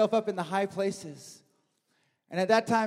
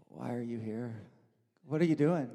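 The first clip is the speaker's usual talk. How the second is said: softer, gentle questioning, hushed; still small voice; 8 semitones lower, 7 dB quieter than usual. Retold or imitated. God's soft voice asking the hiding prophet, hushed. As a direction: ask it quietly, like a still small voice. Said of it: "the voice was like".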